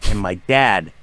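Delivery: ordinary talk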